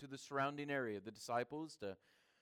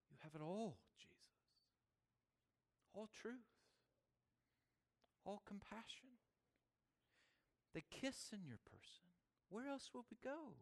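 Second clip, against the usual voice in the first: very soft, higher-pitched, reverent wonder; hushed address to Jesus; 6 semitones higher, 12 dB quieter than usual. Acted. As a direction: drop to a hushed, lifted voice, leaving long pauses. Rapt address to Jesus in sermon.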